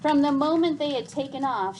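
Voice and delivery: plain reading voice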